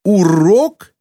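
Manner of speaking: high, indignant question, rising sharply; mild furious yell. A narrator's reading. Girl retorts to her driving teacher, mildly furious. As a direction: throw it back in outraged disbelief. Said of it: "яростно завопила она в ответ".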